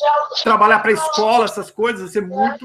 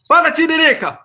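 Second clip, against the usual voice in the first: louder greeting, pitched up a little; loud call-out; 5 semitones higher, 5 dB louder than usual. Real, not acted. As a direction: call out to get the caller talking. Calling the silent caller again.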